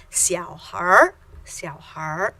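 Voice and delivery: "xiao har" with an r added on "har"; erhua xiao har. Shown as a demonstration. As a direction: curl the tongue on "har" after "xiao". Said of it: "We add an R".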